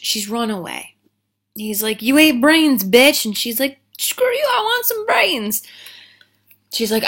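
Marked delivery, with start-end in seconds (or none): 2.0-3.2 loud, raised-pitch accusation; mock angry yell. Boyfriend accusing his girlfriend of eating brains, mock angry yell. Acted lovers' quarrel in retelling. 4.0-5.6 very high, loud comeback; mock defiant screech. Girlfriend snapping back at her boyfriend, mock defiant screech.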